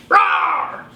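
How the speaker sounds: low, breathy growl roar; monster roar. Acted. Baby roars.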